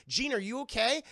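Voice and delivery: acted anxious concern; mock alarm. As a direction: call out as an alarmed friend. Acting worried friend Taryn.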